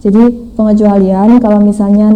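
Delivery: ordinary delivery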